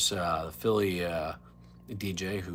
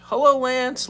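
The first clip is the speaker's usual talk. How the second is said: excited greeting; 11 dB louder, slower than usual. Real, not acted. Greeting arriving guest loudly.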